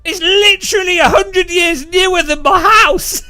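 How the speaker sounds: high, loud mock outrage; exasperated shout. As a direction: yell it in comic disbelief. Acted exasperated reply to awed tourists.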